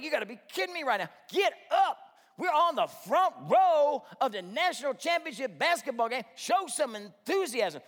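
loud, high, urging shout; worked-up sports fan scolding; exasperated outburst. Acted. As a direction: yell at a friend to wake up and care. Acted exasperation at bored friend.

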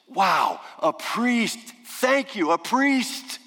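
high, bright mock relief at a rescuer; mock delight. Preacher feigning joy as the priest appears, high mock delight. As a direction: act thrilled that help has arrived.

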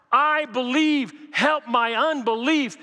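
high, slow pleading cry. Acted. Father crying out to Jesus for his child, pleading. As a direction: beg it like a desperate father. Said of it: "It is a cry".

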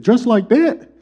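incredulous dad. Retold or imitated. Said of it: "He said".

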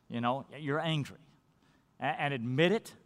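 ordinary talk, nothing marked

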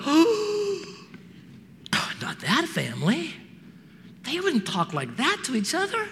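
gasp, then a high, scandalized "oh"; mock shock. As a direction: play a scandalized churchgoer. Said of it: "Would anyone be shocked".

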